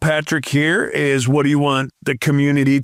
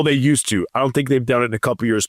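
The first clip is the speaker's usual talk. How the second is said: ordinary talk, nothing marked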